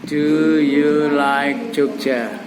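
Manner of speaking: very slow, drawn-out question; slow teaching example, dropping at the end; slow model phrase. Shown as a demonstration. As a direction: spell the question out word by word. Models question for class to repeat.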